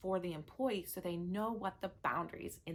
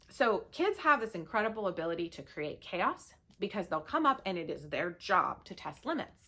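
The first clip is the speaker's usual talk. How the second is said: ordinary delivery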